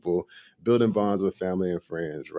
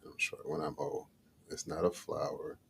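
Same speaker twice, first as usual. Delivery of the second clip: whispering to himself; 3 semitones lower, 15 dB quieter than usual. Real, not acted. Muttering riddle to himself.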